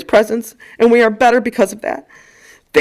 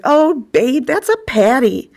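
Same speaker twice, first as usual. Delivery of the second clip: Iowa nurse; 2 semitones higher, much slower than usual. Retold or imitated. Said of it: "She's like".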